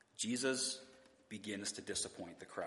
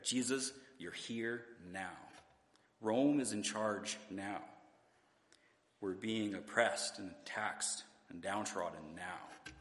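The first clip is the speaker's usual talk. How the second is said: each line ends on a weighted, slowed "now"; stress on now; slower than usual. Shown as a demonstration. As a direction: lean on every "now", slowly. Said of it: "their focus is on the word now".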